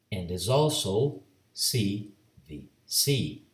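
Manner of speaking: ordinary delivery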